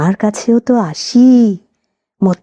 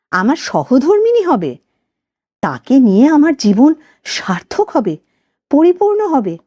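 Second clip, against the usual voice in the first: higher, firm lover's reply; firm Samar; 6 semitones higher than usual. Acted. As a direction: answer steadily, holding your ground. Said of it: "সে বেশ দৃঢ় কণ্ঠেই বলল".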